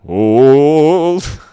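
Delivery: loud drawn-out call of hold; mock battlefield command; commander's order. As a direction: shout it like a commander holding the line. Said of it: "if I was the commander".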